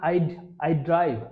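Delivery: I'd drawn out slowly before drive; slow contraction demo. Shown as a demonstration. Teacher slowly models the contraction I'd. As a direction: stretch I'd slowly then add drive. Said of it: "এই ডিটা এখানে মূলত would".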